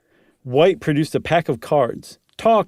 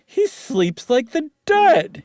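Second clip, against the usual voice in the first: grimacing; 11 semitones higher, slower than usual. Acted.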